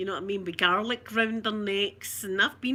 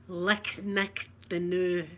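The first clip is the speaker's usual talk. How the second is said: slow explanation; 3 semitones lower, much slower than usual. Shown as a demonstration. Explaining the Scots phrase to viewers, slow and careful. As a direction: spell out each word slowly. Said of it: "It's a braw moon, it's a good moon".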